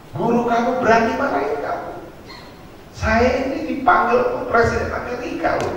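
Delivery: high-pitched mock outrage of a proud dad; indignant father. Father boasting to his crying child, indignant. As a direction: puff up like an offended father.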